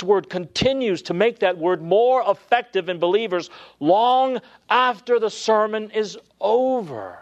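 fervent sermon climax; building preaching fervor. Real, not acted. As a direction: rise into full preaching force.

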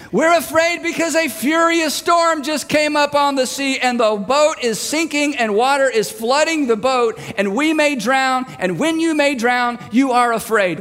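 indignant high shout, easing down gradually; high exasperated outburst, settling as it goes; yelled answer. Acted. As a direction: yell the obvious reason back over the storm. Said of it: "Everyone is yelling".

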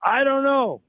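a hammed-up old man yelling up the stairs; an old brother shouting to his brother upstairs; mock yell back. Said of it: "the ninety-four-year-old yells back".

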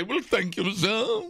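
a prim society lady, pitched high. Shown as a demonstration. Host demonstrates his high society thank you. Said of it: "I say".

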